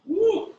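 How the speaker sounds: high "woo" whoop. Real, not acted. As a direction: let out a real high-pitched woo from the stretch. Feels the stretch mid-exercise.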